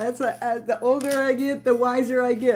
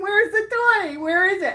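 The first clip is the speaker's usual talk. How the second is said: pet talk; 8 semitones higher, 3 dB louder than usual. Real, not acted. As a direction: coax the dog like a puppy. Talking excitedly to her dog.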